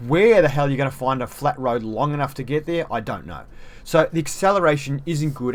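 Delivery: usual speaking voice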